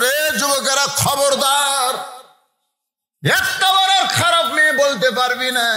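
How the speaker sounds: angry, high-pitched warning; preacher's acted furious rebuke; Bayezid Bostami. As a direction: scold the young men in a high, ringing chant. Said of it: "Bayezid Bostami রাগ করে বলে".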